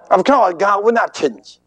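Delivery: ordinary delivery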